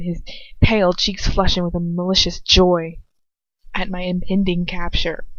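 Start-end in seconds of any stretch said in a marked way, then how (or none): none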